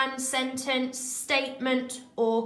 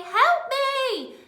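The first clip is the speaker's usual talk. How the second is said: much higher and louder, a cry for help; shouted command; 7 dB louder than usual. Shown as a demonstration. Teacher demonstrating a shouted command for the class, loud and high. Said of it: "if you are shouting".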